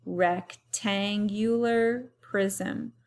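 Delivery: "rectangular prism" drawn out at half speed; slow for repeating. Shown as a demonstration. Teacher to class, leading them to repeat the word slowly. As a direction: say it slowly so they can follow. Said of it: "Say it with me".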